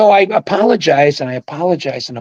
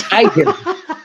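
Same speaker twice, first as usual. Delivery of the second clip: high incredulous echo; 14 semitones higher, slower than usual. Retold or imitated. Stunned echo of accusation.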